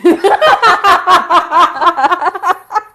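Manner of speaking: loud, high, rapid cackling; real high-pitched laughing fit; burst of laughter. Real, not acted. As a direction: cackle hard at a prank that landed. Prank has just been revealed.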